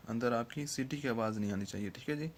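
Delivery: ordinary talk, nothing marked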